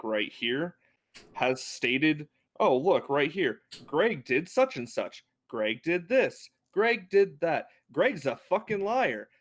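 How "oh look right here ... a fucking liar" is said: higher, slow, pointed sing-song list; sarcastic mock reading of the evidence; mocking accusation. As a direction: rub each charge in, slowly. Mock-reads book to accuse captive.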